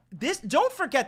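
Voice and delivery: high, loud urgent reminder; excited emphasis. Real, not acted. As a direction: hit "don't" hard.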